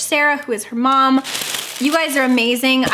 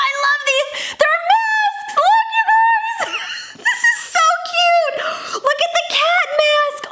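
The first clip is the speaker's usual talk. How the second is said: squealing delight. Real, not acted. Squealing over cat masks.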